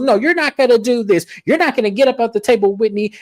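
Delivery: high-pitched mock scolding; indignant protest. Lisa stops Whitney leaving the table, indignant. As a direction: act out a worked-up refusal.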